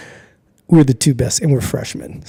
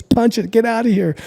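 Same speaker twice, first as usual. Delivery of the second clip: high urgent getaway voice; 10 semitones higher than usual. Retold or imitated. Friend urging getaway.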